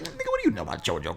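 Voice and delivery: high, rapid mock challenge; quick, high-pitched retort; challenging. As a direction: fire it off high and fast, incredulous. Acts out challenging a newcomer.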